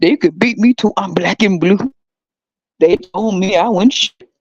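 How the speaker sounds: slow, drawn-out complaining elder; mock baby boomer. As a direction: drag out the old grievances slowly. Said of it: "they be like".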